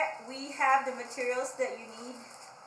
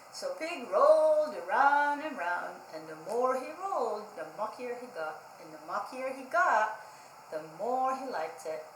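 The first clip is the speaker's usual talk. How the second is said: put-on lively storybook read-aloud for kids; reading to children; 2 semitones higher, 4 dB louder than usual. A narrator's reading. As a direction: read it like library story time, bright and engaged. Reads picture book to children.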